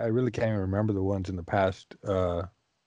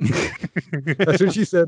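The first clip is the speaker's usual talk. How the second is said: joking voice; 6 semitones higher, much slower than usual. Acted. Joke punchline, put-on voice.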